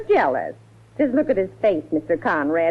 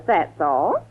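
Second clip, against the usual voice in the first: usual speaking voice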